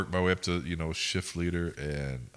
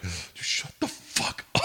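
slow, voiceless stage whisper; retold hissed hushing; slower than usual. Shift leader to worker by the open window, mock urgent whisper. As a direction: hiss it so the customers won't hear.

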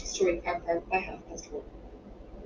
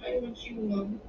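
quiet, wobbly little plea to Mum; faltering child; 3 semitones higher, 4 dB quieter than usual. A narrator's reading. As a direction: falter softly, close to tears. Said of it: "Her voice was faltering".

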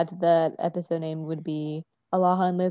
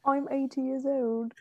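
soft put-on granny voice; British grandmother; 3 semitones higher, 6 dB quieter than usual. Co-host echoing the grandmother joke, soft old-lady voice. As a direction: play a mock old British lady, softly. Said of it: "Like, like a grandmother".

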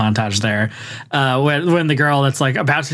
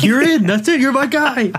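much higher, excited mock producer; gushing quote of an instant yes; imitated thrilled producer; 12 semitones higher than usual. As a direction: pitch right up, hire on the spot. Producer instantly awards the part.

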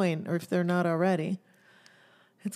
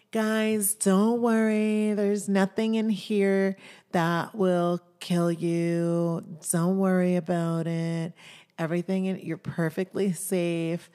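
retold tour guide; 5 dB louder than usual. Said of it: "repeat what one of the ladies said".

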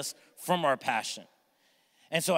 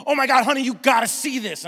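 excited wife; 8 semitones higher, 7 dB louder than usual. Retold or imitated.

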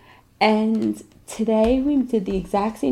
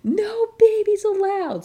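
retold kid scream; 12 semitones higher than usual. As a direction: imitate a screaming kid in a high voice. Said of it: "screaming at the top of their lungs".

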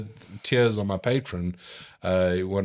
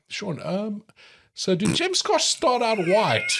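high, slow, baffled question; puzzled mate; 13 semitones higher, much slower than usual. Retold or imitated. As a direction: play the confused friend asking, stretched out and high. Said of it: "he's like".

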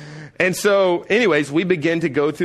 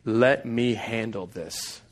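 softer, slow, calm; reassuring God; 4 semitones lower, 6 dB quieter, slower than usual. Retold or imitated. God reassuring the wounded listener, soft and slow. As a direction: soothe the hurt person. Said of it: "God is saying".

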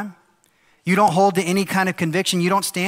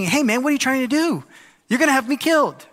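much higher, hammed-up complaining; mock confrontation; 9 semitones higher, 3 dB louder than usual. Pastor imagining Daniel griping at the king, hammed-up indignant. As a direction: play it like an indignant guy calling out the boss.

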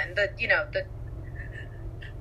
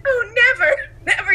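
coy princess; 10 semitones higher, 4 dB louder than usual. Retold or imitated. Acting out Aurora refusing.

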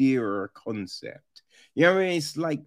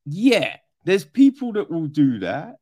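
normal talking voice

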